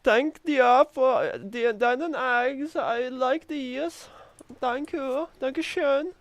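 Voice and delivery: high, slow mock German accent; exaggerated German accent, high and drawn out; German guy voice. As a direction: ham up a squeaky German character thanking you. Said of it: "I'm suddenly a German guy".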